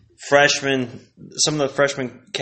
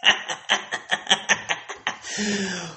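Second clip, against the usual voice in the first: laughing; 8 semitones higher, 6 dB quieter than usual. Real, not acted.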